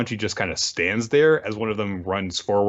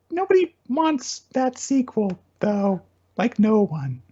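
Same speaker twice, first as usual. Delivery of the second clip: much higher, teasing put-down; high mocking dismissal; 12 semitones higher than usual. Real, not acted. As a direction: scoff at the idea in a high voice. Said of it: "mocking retort".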